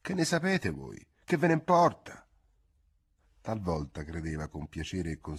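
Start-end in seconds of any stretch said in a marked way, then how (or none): 0.0-2.3 high-pitched exasperated retort; fed-up girl, voice pitched up. Acted. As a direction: snap back at the nosy old woman. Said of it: "rispondeva quasi con esasperazione".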